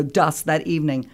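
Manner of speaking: ordinary talk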